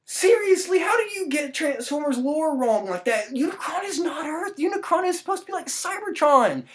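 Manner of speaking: high, incredulous fan outrage; exasperated fan. Retold or imitated. Fan griping at the movie, high and incredulous. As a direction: scoff at lore done wrong. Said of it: "I've never gone like".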